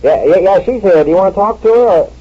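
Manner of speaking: high, chirpy telephone chatter; bright phone voice. Acted. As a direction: sound cheerful for the caller.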